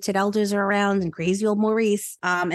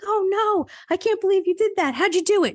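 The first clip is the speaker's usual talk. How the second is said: mock horror; 11 semitones higher, 3 dB louder than usual. Queen hears of brother's killing.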